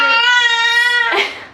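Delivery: high, loud, steady imitated whir; one long high-pitched noise for fast motion; imitated rushing noise. Shown as a demonstration. Mimes things rushing by fast.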